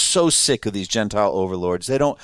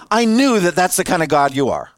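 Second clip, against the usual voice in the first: higher, louder fed-up complaint; exasperated Jonah; 5 semitones higher, 3 dB louder than usual. Retold or imitated. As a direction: complain to God with disgust. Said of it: "Said it like that".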